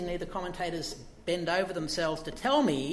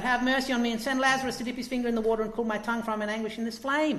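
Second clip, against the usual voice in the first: fast, higher desperate plea; crying out; 6 semitones higher, 4 dB louder, much faster than usual. Retold or imitated. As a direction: rush the plea out in anguish. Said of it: "he cries out".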